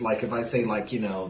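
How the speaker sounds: ordinary talk, nothing marked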